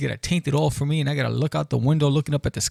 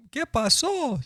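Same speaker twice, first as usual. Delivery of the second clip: high mom voice; 12 semitones higher, slower than usual. Retold or imitated. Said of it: "the mom comes running in".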